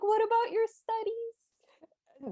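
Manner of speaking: high, drawn-out imitation of a plea; plaintive mock call. Tutor calling to pupils riding off, plaintive mock call. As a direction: plead after them in a high, slow mock wail. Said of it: "yelling plaintively".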